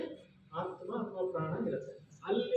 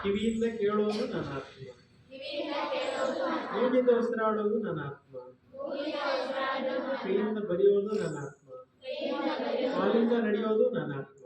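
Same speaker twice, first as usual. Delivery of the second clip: raised teacher's chant, falling at each end; lead-in for repetition; 4 semitones higher than usual. Shown as a demonstration. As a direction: lead the class line by line, pitched up. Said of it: "ಹೇಳಿ". (ತರಗತಿಗೆ ಏರುದನಿಯಲ್ಲಿ ಹೇಳಿಕೊಡುವುದು)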